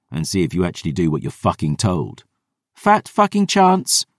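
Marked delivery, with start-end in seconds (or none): 2.8-4.1 put-on slow, high, scornful young woman; defiant girl voice. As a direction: drag out the retort. Dior retorts defiantly.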